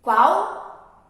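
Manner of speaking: ordinary delivery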